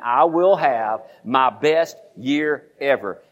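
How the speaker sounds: very slow, each word spaced; drawn-out call-and-response vow; slow declaration. Shown as a demonstration. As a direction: lead the congregation word by word. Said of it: "Say it again".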